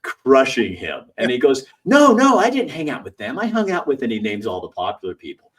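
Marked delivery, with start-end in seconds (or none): 1.8-4.0 high, loud hurried mock protest; mock old classmate squealing no; panicked denial. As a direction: deny it fast, flustered. Bully denying unpopular friends.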